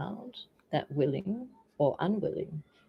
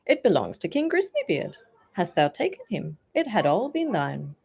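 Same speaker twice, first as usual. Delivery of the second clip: louder, at first much higher taunt; teasing musician; 4 semitones higher, 6 dB louder than usual. A narrator's reading. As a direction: rub it in gently.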